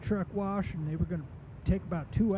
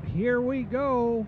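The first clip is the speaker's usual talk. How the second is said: slow, loud, high "here we go"; drawn-out catchphrase; 5 semitones higher, 6 dB louder, much slower than usual. Shown as a demonstration. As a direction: stretch it out like a sign-off. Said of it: "go for it".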